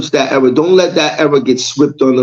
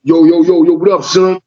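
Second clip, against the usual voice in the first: lightly hyped-up street greeting, higher; mock street slang; 4 semitones higher than usual. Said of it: "I talk like".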